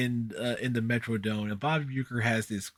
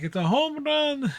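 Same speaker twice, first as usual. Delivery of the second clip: home run call; 13 semitones higher, 3 dB louder than usual. Retold or imitated. Imitating Spanish home run call.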